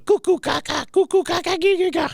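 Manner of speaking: very high cooing gibberish; weird baby talk. Acted. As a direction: babble at a baby in a squeaky high voice. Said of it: "just the weirdest baby talk".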